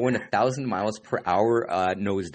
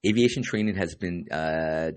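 normal talking voice

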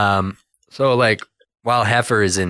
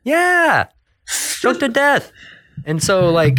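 excited; 14 semitones higher, slower than usual. Real, not acted. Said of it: "reacting to chokey chicken".

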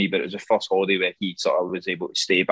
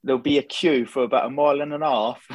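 higher-pitched put-on English accent; English accent impression; 4 semitones higher than usual. Shown as a demonstration. Scot answering a question as an Englishman, English accent. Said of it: "try and say it in English".

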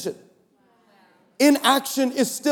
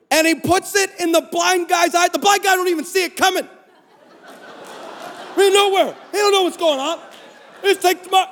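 higher, building to a shouted exclaim; excited storytelling; 8 semitones higher than usual. Retold or imitated. Comic retelling of Jesus spitting.

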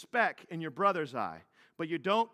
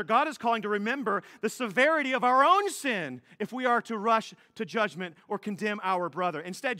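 ordinary delivery

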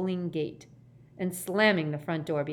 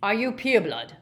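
louder, higher demand; suddenly aggressive; 4 semitones higher, 6 dB louder than usual. Acted. Gaunt challenging the official, suddenly aggressive. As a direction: confrontational question. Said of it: "suddenly aggressive".

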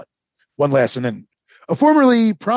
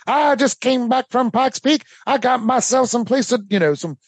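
boastful trader pitch; 6 semitones higher than usual. Retold or imitated. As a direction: sell it like a carnival barker. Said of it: "he's talking it up".

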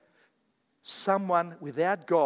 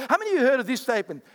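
ordinary delivery

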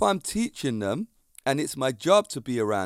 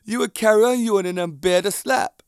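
excited; 6 semitones higher, 6 dB louder than usual. Real, not acted. Loud laughing challenge.

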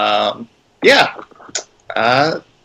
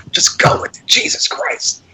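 whispered aside. Real, not acted.

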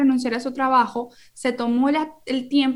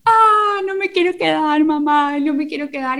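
put-on crying child; 4 semitones higher, 5 dB louder than usual. Child crying at kindergarten drop-off.

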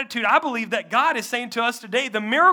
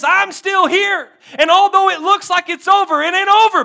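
rising proclamation; 6 semitones higher, 8 dB louder than usual. Acted. Preacher voicing Jesus to the church, triumphant higher louder cry. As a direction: proclaim it like victory.

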